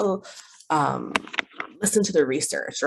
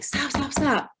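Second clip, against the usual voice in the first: repeated nagging; 7 semitones higher than usual. Shown as a demonstration. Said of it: "we don't wanna be saying".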